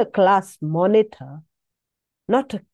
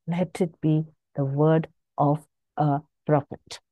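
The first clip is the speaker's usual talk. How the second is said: ordinary talk, nothing marked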